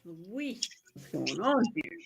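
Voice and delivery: faint mic voice; barely picked up by the mic; barely audible. Real, not acted. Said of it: "I can barely hear you".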